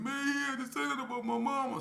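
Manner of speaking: wounded about his mother; crying K P. Retold or imitated. K P in the hallway, imitated tearful high voice. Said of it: "crying with it".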